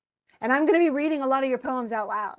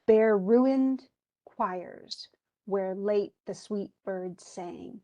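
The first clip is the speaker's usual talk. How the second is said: slow, metrical poetry reading; measured verse rhythm; slower than usual. Shown as a demonstration. Teacher reciting a sonnet so students hear its rhythm, slow and measured. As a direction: draw the lines out slowly so the beat is audible. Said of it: "Do you hear that?".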